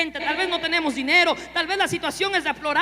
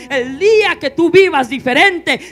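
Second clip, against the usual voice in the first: angry confrontation; 12 dB louder, slower than usual. Acted. Son confronts drunk father.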